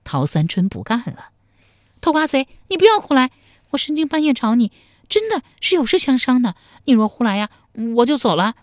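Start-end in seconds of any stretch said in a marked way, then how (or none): 2.0-8.6 startled protest. A narrator's reading. Tao Sanchun rebuffing his advances.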